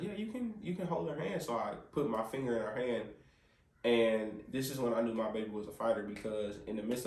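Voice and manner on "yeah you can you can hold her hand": high, soft nurse voice; light, higher-voiced impression of a nurse; imitated nurse. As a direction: gently give permission, pitched well up. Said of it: "the nurse was like".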